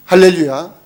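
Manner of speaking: loud rising hallelujah; exultant hallelujah shout; joyful shout. Real, not acted. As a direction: cry out the praise. Preacher exclaims praise.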